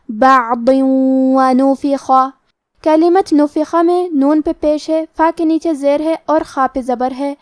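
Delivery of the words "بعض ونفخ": بعض ونفخ drawn out very slowly, tanween merged into و with ghunna; tajweed demo. Shown as a demonstration. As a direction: stretch بعض ونفخ with nasal merging. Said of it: "غنہ اور تشدید کے ساتھ ادا کریں گے". (بعض ونفخ غنہ کے ساتھ آہستہ)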